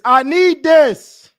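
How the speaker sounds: loud, slow, stretched-out need; desperate plea. Real, not acted. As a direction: beg the football gods out loud. Pleading for the win.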